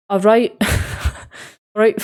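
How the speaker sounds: jokey British accent, breaking into a laugh. Shown as a demonstration. Streamer showing how they greet friends, playful British accent. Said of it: "I'll put on a British accent".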